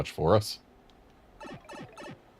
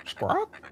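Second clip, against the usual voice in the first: parrot squawk; 6 dB quieter than usual. Acted.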